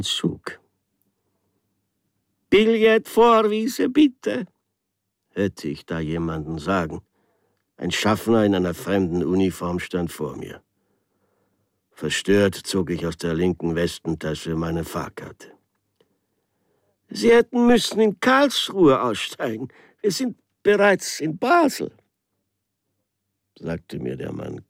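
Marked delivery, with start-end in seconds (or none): 2.5-4.4 high, slow, sing-song official call; a foreign conductor asking for tickets; Schaffner, hoch und singend. Acted. 17.1-21.9 high, loud, head-shaking official voice; conductor. Acted.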